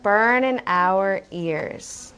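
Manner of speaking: slow, low "Burn in" for burning, no g; dropped g. Shown as a demonstration. Teacher having children listen, slow dropped-g "Burn in". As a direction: stretch "Burn in" slowly without the g. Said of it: "instead of burning".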